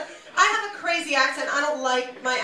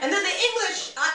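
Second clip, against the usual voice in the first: usual speaking voice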